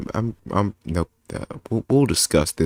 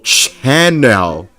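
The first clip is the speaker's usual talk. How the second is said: loud, high, stretched final word; grandiose sign-off; 4 semitones higher, 8 dB louder than usual. Pretend. Streamer ending the channel plug, hammed-up grand voice. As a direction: finish the plug with mock pomp.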